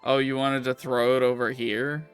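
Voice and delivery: ordinary talk, nothing marked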